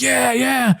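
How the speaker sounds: drawn-out high fan cheer; cheering crowd. Retold or imitated. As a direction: imitate a whole crowd going wild, held back. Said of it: "everyone's like".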